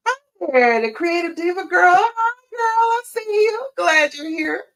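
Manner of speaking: very high, loud, delighted squeal of hello; excited greeting. Real, not acted. Greeting viewers who join the live.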